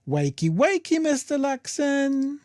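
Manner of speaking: high, slow wakey wakey; sing-song wake-up call. Pretend. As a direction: drawl it like waking a child. Waking a sleeper, sing-song.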